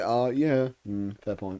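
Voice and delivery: normal talking voice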